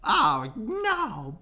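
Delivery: high little buzzing voice; buzzing bees. A narrator's reading. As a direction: buzz it out in alarm. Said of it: "buzzed the bees".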